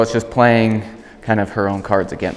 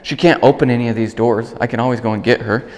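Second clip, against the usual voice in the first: ordinary talk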